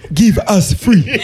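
slow, high laughing "Give us free". Real, not acted. Laughing while echoing host's Amistad line, slow and high.

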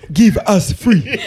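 slow, high, hammed-up plea; mock plea for freedom. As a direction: cry out for freedom in jest. Joking movie-style plea for freedom.

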